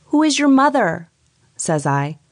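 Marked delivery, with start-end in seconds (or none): 0.1-1.1 startled loud question. A narrator's reading. Startled narrator questions ghost child.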